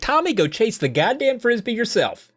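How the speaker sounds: put-on high, fed-up yell at the kid; exasperated mom. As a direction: throw the line at Tommy, annoyed. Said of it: "She's like".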